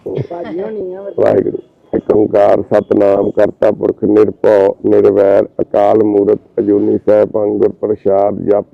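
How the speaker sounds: slow, low, loud chanted prayer; prayer recital. Acted. Man blessing a sick young brother, low slow prayer chant. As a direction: intone the Mool Mantar slowly and deep. Recites Mool Mantar over boy.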